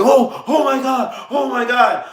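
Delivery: high shocked "oh my God" from the nurses; panicked gasp. Retold or imitated. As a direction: voice jumps up high in alarm.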